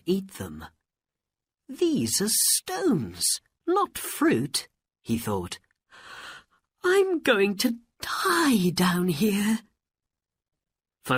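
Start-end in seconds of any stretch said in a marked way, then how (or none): 1.7-4.7 high, slow realisation; dismayed. Acted. Hungry Aladdin finding stones instead of fruit, dismayed. 6.8-9.6 high, drawn-out acted despair; slow wail of a trapped boy. Aladdin to himself, trapped underground, despairing.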